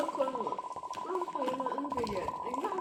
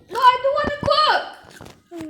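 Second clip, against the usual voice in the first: whiny loud refusal; 9 semitones higher, 14 dB louder, slower than usual. Acted. Refusing to cook in game.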